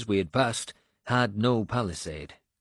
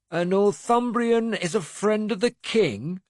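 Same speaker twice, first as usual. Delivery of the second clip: scornful; 12 semitones higher, 4 dB louder than usual. A narrator's reading. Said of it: "he asked scornfully".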